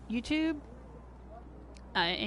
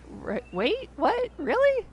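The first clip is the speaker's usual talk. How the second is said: mock astonishment; 12 semitones higher, slower than usual. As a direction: stammer into a high-pitched double-take. Retells own baffled reaction.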